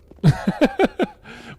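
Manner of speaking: high, loud burst of laughter; real laugh. Real, not acted. Player amused at what they just did, high loud laugh. Laughs at own random shooting.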